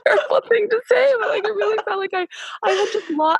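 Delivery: high, fast laugh-cry; real laughter breaking into tears; half laughing, half crying as she confesses; laughing through tears. Real, not acted. Laughing through tears about birth.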